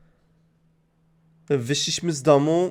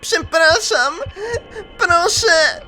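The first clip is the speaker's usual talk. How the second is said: unhinged yandere. Acted. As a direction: shriek it like a mad killer girl. Gracz gra szaloną Yanderę. (bardzo wysoki, obłąkany wrzask yandere)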